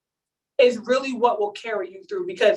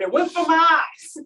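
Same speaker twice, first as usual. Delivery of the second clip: lightly voiced mom, a touch higher; mock mom; 5 semitones higher than usual. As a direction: imitate her indignant claim. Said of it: "was her exact word".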